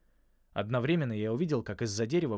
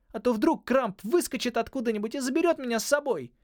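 panicked; 13 semitones higher, 6 dB louder than usual. Acted. Holly's panicked plea continues.

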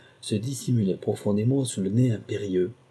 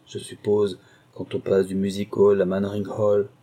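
plain reading voice

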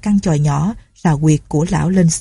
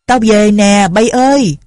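raised, higher calling voice; loud call; 4 semitones higher, 5 dB louder, slower than usual. A narrator's reading. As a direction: call out to the household on arriving. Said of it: "nói to".

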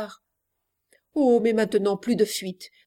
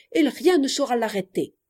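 ordinary talk

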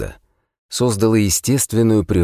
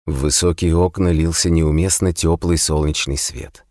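ordinary delivery

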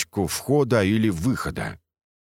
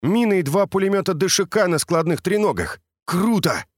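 higher, eager and thrilled; excited; 10 semitones higher than usual. A narrator's reading. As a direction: gush about the find. Misha excited about weapons.

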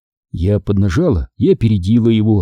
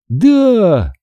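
high, gleeful yes; joyful exclamation; 9 semitones higher than usual. A narrator's reading. Daughter answering mother in the kitchen, joyful. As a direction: exclaim it with delight. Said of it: "радостно воскликнула я".

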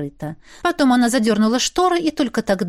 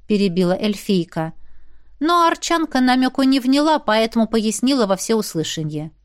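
plain reading voice

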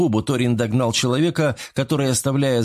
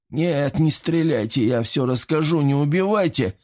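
higher, pained begging; groaning plea; 5 semitones higher than usual. A narrator's reading. Wounded traitor begging the operative, moaning in fear. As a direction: beg for your life, groaning. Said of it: "простонал мужчина".

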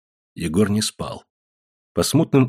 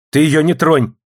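raised-voice warning; sharp scolding; 6 semitones higher, 5 dB louder than usual. A narrator's reading. Mother rebukes son to protect his wife, sharp scolding. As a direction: tell him off loudly, higher in pitch. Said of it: "прикрикнула на сына мать".

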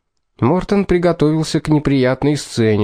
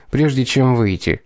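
ordinary delivery, nothing marked

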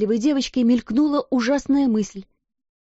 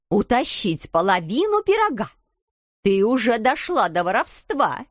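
angry shouting; 4 semitones higher than usual. A narrator's reading. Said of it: "продолжала кричать".

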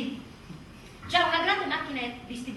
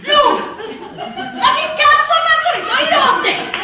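shrill, hammed-up yelling, much higher and louder; mock panicked yelling; 16 dB louder, slower than usual. Husband panicking at the birth, hammed-up yelling. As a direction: yell it like a husband losing it.

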